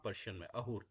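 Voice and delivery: ordinary talk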